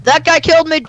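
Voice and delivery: high, loud urgent shout; hyped gamer bro. Real, not acted. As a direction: yell at your friend to attack. Just killed, urging revenge.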